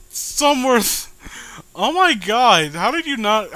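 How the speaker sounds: can't believe the writing, still laughing; oh-my-God disbelief through laughter; incredulous laughing. Real, not acted.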